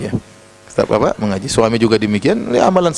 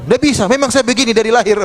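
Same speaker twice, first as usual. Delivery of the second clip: acting out a defensive wife's raised voice; mock indignant, much higher-pitched comeback; stubborn high-pitched retort; 9 semitones higher than usual. Stubborn wife answering her husband's plea, mock high-toned retort. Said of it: "berbicara kepada suami dengan nada tinggi".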